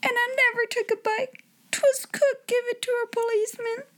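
high muffled sobbing; crying into pillow. A narrator's reading. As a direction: weep into the pillow. Becky crying in her attic.

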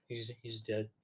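very soft, low imitation; hushed, low retold line; mock dying murmur. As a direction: barely voice it, playing a shot man. Retelling Doc lying shot.

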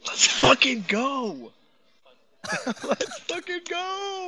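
drawn-out hype yell, high and loud; slow triumphant yell with a laugh; excited shout. Real, not acted. Celebrating a big NFT floor sweep.

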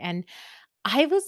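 ordinary delivery

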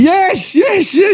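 gleeful high agreement; excited yes. Real, not acted. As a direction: jump in excitedly, voice leaping up.

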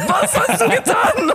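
high, loud and slow mock outrage, cracking into laughter; a hammed-up horrified "what have you done?"; shocked outcry. Türken schockiert nachgemacht.

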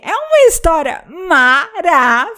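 slow, exaggerated sarcastic praise; ironic praise. Podcaster mocking a webtoon she hates, sarcastic. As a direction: gush over the story with obvious irony. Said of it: "a gente fala tudo com o tom irônico".

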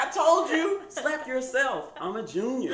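high, laughing, drawn out slap; student gloating at the symposium, high and laughing; cocky taunt. Retold or imitated. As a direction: gloat at her. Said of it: "I was like".

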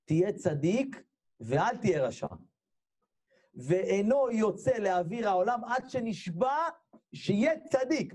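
high, slow, drawn-out solemn charge; angel administering oath. Acted. Angel swearing in an unborn soul, high slow intoning. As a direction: intone it high, stretching each phrase. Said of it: "בא מלאך ונתן לנו סטירה".

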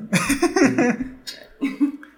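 high burst of laughter. Real, not acted. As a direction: break up laughing at yourself. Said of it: "Eso ya no es voz de trailer". (carcajada aguda)

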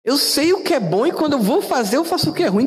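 a hammed-up, high-pitched take on the apostle's confession; mock Paul quote. Preacher voices Paulo admitting he does wrong, high mock tone. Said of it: "É por isso que Paulo diz".

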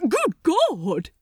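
high, loud startled cry; shocked exclamation. Acted. Aziraphale blinded by the spotlight, crying out in shock. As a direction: blurt it out in shock. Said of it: "he exclaimed in shock".

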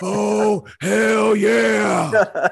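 brash trash-talking promo, shouted high; Stone Cold Steve Austin. Retold or imitated. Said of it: "I can give you a good Austin".

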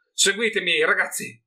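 high, loud call to the men; rallying cry. A narrator's reading. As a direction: rouse the mercenaries to charge. Haynes rallies his mercenaries.

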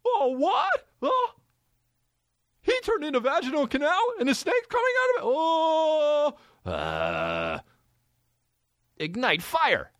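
squeaky high anime character voice; shrill anime voice. Retold or imitated. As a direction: shriek it in a high, cartoonish anime voice. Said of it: "And he'll be like".

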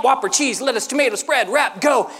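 quick, steady rhythmic chant; on beat. Retold or imitated. Brother still making Whoppers, steady chant on beat. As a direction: rattle off the list without missing a beat. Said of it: "didn't even miss a beat".

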